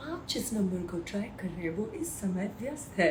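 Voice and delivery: fast, soft recorded-message voice; telephone operator voice. Pretend. Imitating the Hindi phone recording for fans, fast and soft. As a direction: rattle it off like the busy-line recording. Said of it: "That's my Hindi voice".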